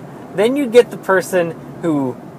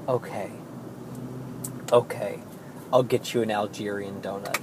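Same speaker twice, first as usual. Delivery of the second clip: much softer, low, defeated murmur; resigned; 9 semitones lower, 14 dB quieter than usual. Retold or imitated. Worker without the heart to correct the customer, quietly resigned. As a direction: give in quietly, voice dropped low. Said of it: "my heart sank".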